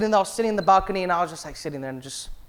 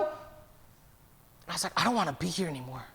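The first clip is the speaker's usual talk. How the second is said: hushed despair; 4 semitones lower, 13 dB quieter than usual. Retold or imitated. Pastor confiding his exhaustion to his brother, hushed and near whispered. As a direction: breathe it out like someone with nothing left.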